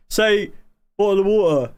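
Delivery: teasing streamer mimics how British people say it, mock British accent. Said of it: "the same with British people where they say".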